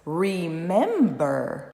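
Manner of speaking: remember drawn out as re-mem-ber, middle beat highest; "remember" stretched into three linked beats; three clear syllables. Shown as a demonstration. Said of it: "uma palavra com três sílabas".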